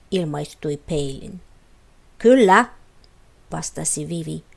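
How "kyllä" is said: firm yes. A narrator's reading. Vivi firmly confirms her choice.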